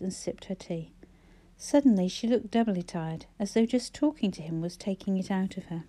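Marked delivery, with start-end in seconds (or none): none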